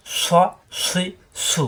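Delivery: স, সুই, সু each with a thick, long hissing s; heavy whistling s. Shown as a demonstration. Teacher drilling the whistling letter, heavy স, সুই, সু. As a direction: press air through the s of স, সুই, সু, keeping them heavy. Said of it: "জিব্বা নিচে যাতে চাপ দিয়ে".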